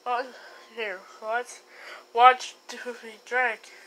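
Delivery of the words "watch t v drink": ordinary talk, nothing marked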